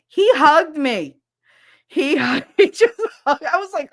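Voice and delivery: thrilled, high and loud; excited. Real, not acted. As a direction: blurt it out thrilled and amazed. Excited retelling of the hug.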